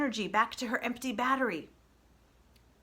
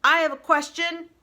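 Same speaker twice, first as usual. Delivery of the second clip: booming, much louder and higher; 8 semitones higher, 12 dB louder than usual. Acted. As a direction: boom it out over the whole flock. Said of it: "came a booming voice".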